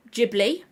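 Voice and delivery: "Ghibli" pronounced JIB-lee, rising. Shown as a demonstration. Vlogger listing option one, Ghibli as JIB-lee. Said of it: "Which, is it one".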